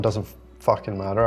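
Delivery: ordinary talk, nothing marked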